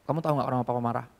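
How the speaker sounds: put-on soft, tender question to a small child; gentle father to child. A father gently asking his child why he got angry, soft and tender. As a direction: ask your little one kindly.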